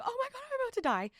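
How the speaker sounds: very high, rushed panic squeak; mock freaking out. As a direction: squeal it fast, imitating your own panic. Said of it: "I was freaking out".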